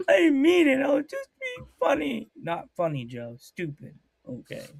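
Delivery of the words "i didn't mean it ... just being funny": very high, whimpering mock excuse; hammed-up tearful apology; mock plea. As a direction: plead in a silly high little voice. Playful mock plea to coworkers.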